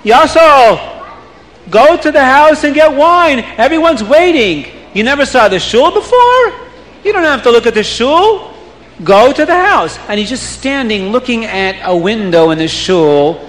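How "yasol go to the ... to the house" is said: put-on raised, urgent calling to a boy; crowd shouting. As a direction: shout out to hurry him up. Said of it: "And the people start screaming".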